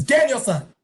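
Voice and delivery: imitated commanding call. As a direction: call the student back like his master. Master calls student back.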